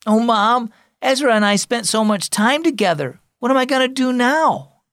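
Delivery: put-on high-pitched boyish lament; sniffly upset. A narrator's reading. Boy turning to mom about lost friend, upset and plaintive. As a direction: plead to Mom with mild, acted distress. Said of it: "Jackson sniffled".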